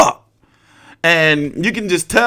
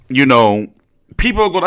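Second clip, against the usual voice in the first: ordinary delivery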